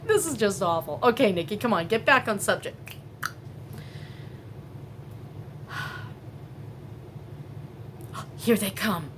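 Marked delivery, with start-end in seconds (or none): 3.0-8.3 long startled breathless gasp; sharp gasp. Real, not acted. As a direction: gasp in shock at the window. Spotting people at the neighbor's trailer.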